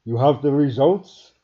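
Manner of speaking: loud, high, changed captain voice; eager captain. A narrator's reading. Alien captain asking scientists, loud and eager. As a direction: demand the results eagerly.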